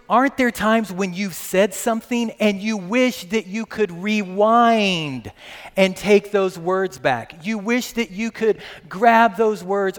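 rising preacher fervor; preaching. Real, not acted. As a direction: preach it out, climbing.

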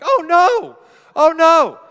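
high, alarmed holler; very loud, high-pitched protest; yelling back. Retold or imitated. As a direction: yell a frantic denial across the distance. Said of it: "Paul yells back".

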